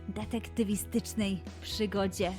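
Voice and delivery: usual speaking voice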